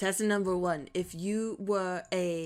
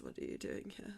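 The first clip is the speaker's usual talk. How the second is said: hushed whispered aside; 13 dB quieter, faster than usual. Acted. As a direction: whisper it like a secret. Aside to own therapist.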